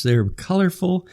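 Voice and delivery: ordinary delivery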